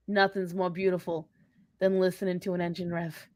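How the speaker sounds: soft, lost in a daydream; dreamy reverie. Real, not acted. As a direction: drift off dreaming about engines. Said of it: "Sorry, I got lost for a minute".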